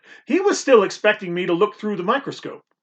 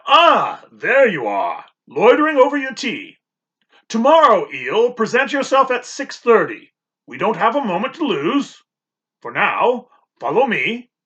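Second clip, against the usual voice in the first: a brisk doctor, higher and louder; doctor telling the boy to be ready at dawn; 5 semitones higher, 5 dB louder than usual. Acted. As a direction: hurry the boy along.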